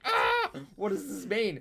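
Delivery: mock shriek then a high, loud frightened question; panicked child. Five-year-old asking her dad what it means, mock panic. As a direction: act the scared little kid. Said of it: "I'm like".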